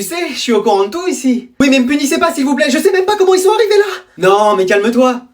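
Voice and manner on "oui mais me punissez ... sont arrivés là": high, pleading pupil; acted panic, pitch jumping up; panicked pleading. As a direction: beg hard to be let off. Pupil begging not to be punished.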